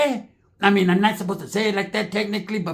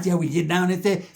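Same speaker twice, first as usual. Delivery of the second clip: ordinary talk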